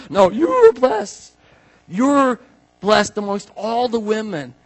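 high, loud exclamation; hammed-up squeal settling into proclamation; excited blessing. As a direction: cry it out in amazement. Acted Elizabeth exclaiming to Mary.

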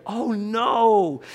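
high, loud cry of mock dismay; acted dismay. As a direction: cry out like an onlooker suddenly caught up in it. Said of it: "He goes from seeing what's happening to like".

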